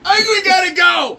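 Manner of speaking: loud, high, stretched-out call; hollered sign-off. Real, not acted. Host ending the stream, high holler. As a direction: holler it to wrap up.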